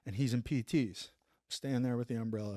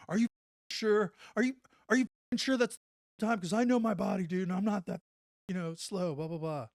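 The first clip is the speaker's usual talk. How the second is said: pissy friend; 7 semitones higher than usual. Retold or imitated. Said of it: "he gets pissy with me".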